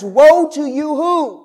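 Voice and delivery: loud, slow call to the congregation; fired-up woe. Acted. Pastor prompting the church to finish Jesus' words, fired up. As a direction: rouse the crowd to answer. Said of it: "Now Jesus is getting fired up".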